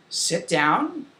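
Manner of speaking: normal talking voice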